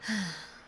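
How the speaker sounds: long falling exhale; wistful sigh. Acted. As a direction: sigh out as you remember. Recalling first sight of beloved.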